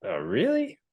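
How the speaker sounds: sharp jump up on really, surprised; disbelieving oh, really. Real, not acted. Surprised reply to friend's opinion.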